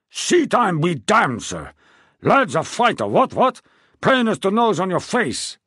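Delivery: loud, high royal bluster; an irritated king loudly insisting; blustering anger. A narrator's reading. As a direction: brush objections aside with a bellow. King overrides Chatham.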